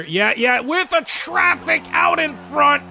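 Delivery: high, loud, slowed goal call. Real, not acted. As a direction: build up and shout the goal. Calling out a goal.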